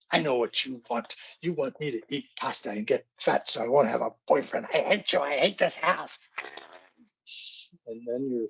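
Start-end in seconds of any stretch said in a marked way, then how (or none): 0.1-6.2 put-on higher, bratty teen voice, rising to a wail at I hate you; accusing teen daughter. Teen daughter lashing out at her mom, higher and whiny. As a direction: throw a teenage tantrum in a whiny voice. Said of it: "She says".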